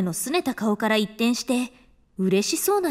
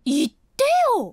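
higher, slow, hurt protest; miffed reproach; 8 semitones higher, 3 dB louder than usual. Acted. Woman upset he hid his passing result, miffed. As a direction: scold him for keeping it from her. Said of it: "怒るくらい気にしてくださっていて".

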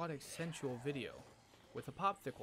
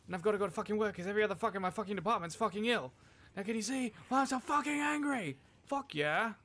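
loud, higher, ranting over every line; furious rant; 5 semitones higher, 5 dB louder than usual. Acted. Ranting about working Sunday while everyone's ill, furious. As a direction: rage about being called in at four am.

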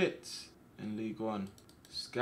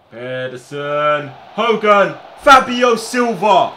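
rising yells of the names, louder and louder; excited shout; 8 semitones higher, 18 dB louder, much slower than usual. Real, not acted. Streamer cheering his players on, excited shout. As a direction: shout the names as the chance builds.

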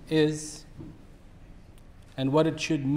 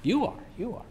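humble; 6 semitones higher, 8 dB quieter than usual. A narrator's reading. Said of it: "very humbly said".

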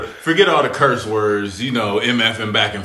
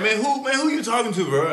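high, incredulous comeback; acting tougher than he felt; mock clapback; 12 semitones higher, faster than usual. Rookie snapping back at Garnett, high incredulous retort. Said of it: "I'm like".

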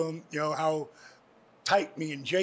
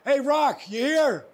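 called out loudly, higher; calling out; 8 semitones higher, 5 dB louder, slower than usual. Retold or imitated. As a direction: shout across the empty locker room. Big Show calling out in locker room.

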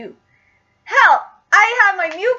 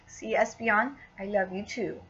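soft cooing; 10 semitones lower, 13 dB quieter than usual. Real, not acted. Cooing to plushie Espeon.

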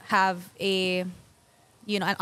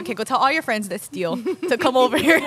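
louder, higher playful order; joking; 4 semitones higher, 6 dB louder than usual. Retold or imitated. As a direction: tease with a grin. Joking invitation to thieves.

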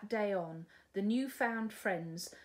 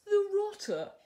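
much higher, louder outraged cry; shocked gasp; 12 semitones higher, 8 dB louder than usual. Acted. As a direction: dragon aghast. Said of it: "gasped Dragon".

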